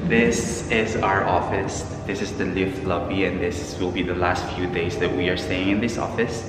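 ordinary delivery

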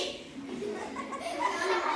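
unvoiced high laugh; breathy laugh. Real, not acted. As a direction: laugh through it. Laughing mid-story.

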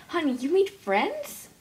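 put-on a mom amazed her kid made friends; lightly voiced surprised mother.

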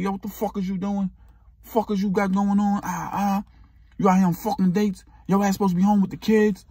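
mock jealous boyfriend. Boyfriend yelling at the date.

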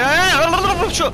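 high, loud frantic yell; real panic rising high; panicked scream. Real, not acted. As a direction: scream out in refusal. Panicked yell as enemy attacks.

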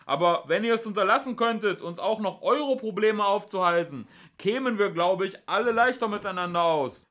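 high, loud exasperated shout; full-voiced, reasoning yell; bellowing. A narrator's reading. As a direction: bellow it across at armed opponents. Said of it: "Ford brüllte los".

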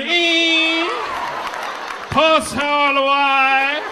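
high, very slow Jamaican accent, mocking. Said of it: "'Cause that's a Jamaican word".

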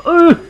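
loud, high strained grunt; pained grunt. Real, not acted. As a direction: grunt as the hit lands.

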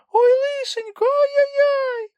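very high, loud, slow wailing squeak; Kulka crying out. A narrator's reading. Kulka panics at the endless sea, high wailing cry. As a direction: lament like a frightened small animal. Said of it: "кричала Кулька".

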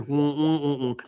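soft muffled wah-wah humming; Charlie Brown's teacher. Retold or imitated. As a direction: hum like the Peanuts teacher. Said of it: "Charlie Brown's teacher".

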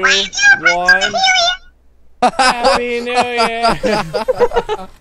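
drawn-out happy shout; excited cheer. Real, not acted. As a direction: cheer it out slowly. Countdown cheer.

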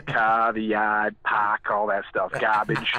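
slow, low New England r-dropping; New England accent. Shown as a demonstration. Showing hosts his words, slow New England accent. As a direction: list them slowly in New England accent. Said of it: "Yeah, I say it all".

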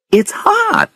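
very high, startled exclamation; startled by heat. A narrator's reading. Woman tells her friend the lake is hot, startled.

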